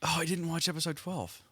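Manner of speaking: ordinary talk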